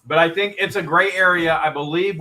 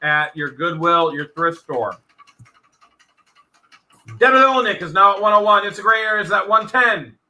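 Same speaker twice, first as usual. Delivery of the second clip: quick, raised auction calling; auctioneer calling bids; 6 semitones higher, 4 dB louder than usual. Real, not acted. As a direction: call out the bids like a live auction.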